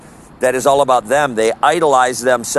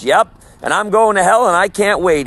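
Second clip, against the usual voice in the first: higher-pitched, mildly gleeful retold mockery; mocking; 6 semitones higher than usual. Passer-by defying a street preacher about hell, lightly imitated mocking. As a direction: imitate a scoffer's cocky, eager reply. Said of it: "laughing and mocking".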